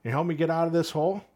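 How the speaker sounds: high, loud, fast call for help; trapped cop calls up to a passing cop, loud and high; calling up from a hole. Retold or imitated. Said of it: "and he goes".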